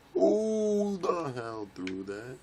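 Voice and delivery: long, high, slow howl falling away; outraged wail. Real, not acted. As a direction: draw out the first word in high outrage. Hit by thrown item in game.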